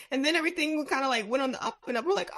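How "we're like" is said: normal talking voice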